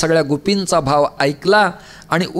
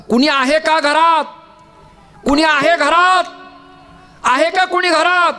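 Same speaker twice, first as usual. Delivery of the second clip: calling out; 10 semitones higher than usual. Acted. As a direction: shout across the courtyard for someone inside. Uddhav calls into the house.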